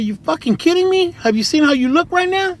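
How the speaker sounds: imagined comeback, voice jumping up in disbelief; incredulous retort. Retold or imitated. To his tweaked-out homie offering to drive, incredulous.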